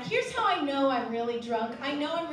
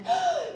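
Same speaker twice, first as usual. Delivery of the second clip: impressed gasp; 3 dB louder than usual. Retold or imitated. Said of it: "they'd be like".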